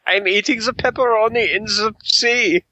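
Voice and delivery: high, hammy German general voice; German accent. Retold or imitated. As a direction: camp German officer accent.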